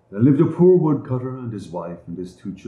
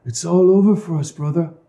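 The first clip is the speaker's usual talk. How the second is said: put-on weeping girl; 7 semitones higher, 4 dB louder than usual. A narrator's reading. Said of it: "she wept bitterly".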